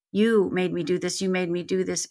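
usual speaking voice

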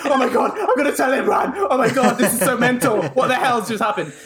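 high-pitched, giddy retold outburst; excited disbelief. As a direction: gush as if bursting to tell a friend. Reenacting excited phone call to friend.

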